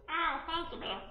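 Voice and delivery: high little squirrel thanks. Acted. Squirrel thanks the bear for the apple. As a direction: chirp your thanks like a squirrel. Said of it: "and the squirrel says".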